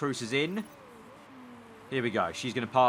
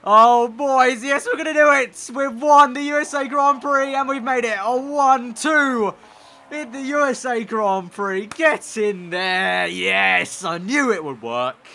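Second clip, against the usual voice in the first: much higher and louder, yelling with joy, drawn out slowly; triumphant shout; 11 semitones higher, 9 dB louder, slower than usual. Real, not acted. Streamer celebrating a one two finish, shouting in joy. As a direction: roar it like you just won the race.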